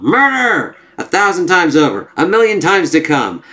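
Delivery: high, wild villain proclamation; ranting villain. Acted. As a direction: declaim it like a crazed killer. Villain boasts of killing in comic.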